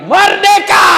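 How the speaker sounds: high, loud triumphant yell; joyful shout. Pretend. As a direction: shout it like freedom has come. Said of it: "dia tutup sambil teriak". (teriakan gembira yang sangat keras dan tinggi)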